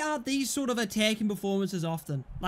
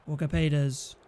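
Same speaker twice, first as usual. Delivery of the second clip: ordinary talk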